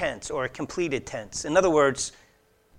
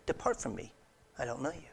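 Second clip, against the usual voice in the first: quiet rejection; 11 dB quieter, faster than usual. Retold or imitated. Preacher quoting Jesus to the rejected many, hushed. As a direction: drop right down, softly turning them away. Voicing Jesus turning people away.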